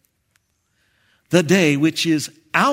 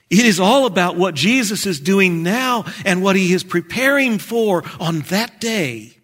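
raised, forceful declaration; emphatic preaching; 5 semitones higher, 6 dB louder than usual. Real, not acted. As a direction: drive the point home to the congregation. Preacher drives home main point.

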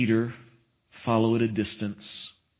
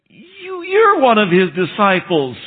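put-on accusing little girl; 9 semitones higher, 7 dB louder than usual. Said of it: "that second little girl said".

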